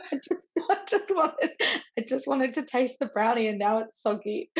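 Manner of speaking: high, laughing mock-lament over the soggy brownie; laughing whine. Real, not acted. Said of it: "I was so upset".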